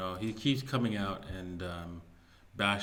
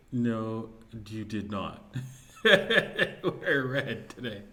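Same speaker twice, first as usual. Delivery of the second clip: slow, louder mock disbelief, breaking into laughter; mock freak-out; 6 dB louder, much slower than usual. Host mimics fellow YouTuber over red shirt, laughing mock freak-out. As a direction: imitate someone freaking out, laughing midway. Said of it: "like, freaking out".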